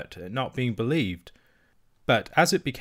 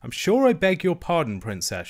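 grudging apology, louder and higher; offended apology; 7 semitones higher, 4 dB louder than usual. Acted. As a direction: say sorry while still miffed. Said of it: "still in an offended tone".

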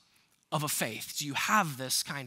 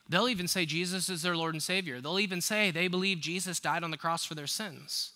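normal talking voice

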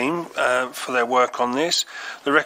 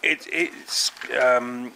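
hoarse, nearly voiceless; raspy whisper; much slower than usual. Real, not acted. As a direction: voice cracking to a whisper. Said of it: "I'm losing my voice".

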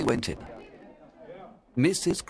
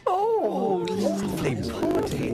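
high, delighted gasp of praise; delighted family; 11 semitones higher, slower than usual. A narrator's reading. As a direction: swoon over the pudding. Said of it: "they said".

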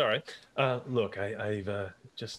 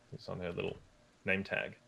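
normal talking voice

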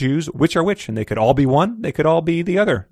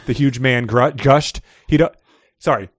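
choked; 5 dB louder than usual. A narrator's reading. Reads a fiction excerpt aloud.

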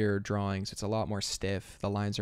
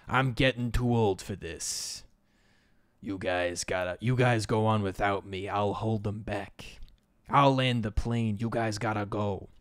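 a lightly voiced weary old soldier, slow; tired old veteran giving his life for the team; hint of a gruff old man, slowed down; gruff old guy. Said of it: "We have gruff old guy".

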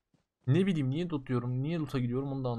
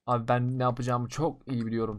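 ordinary talk, nothing marked

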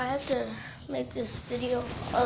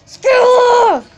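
loud shout; 11 semitones higher, 18 dB louder than usual. Real, not acted. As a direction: yell it at the screen. Shouting in game.

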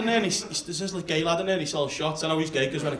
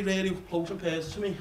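ordinary talk

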